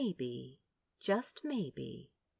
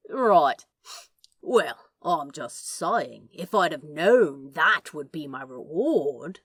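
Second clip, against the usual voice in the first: louder, slow lopsided tease; slow drawling demon; 3 semitones lower, 8 dB louder than usual. Acted. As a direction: drag it out, smirking.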